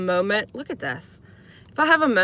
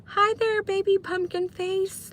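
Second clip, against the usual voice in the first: high, slow syrupy pet-name voice; mock lovey-dovey cooing; sugary sweet talk; 12 semitones higher, slower than usual. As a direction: coo it mockingly at a partner. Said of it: "it's literally like".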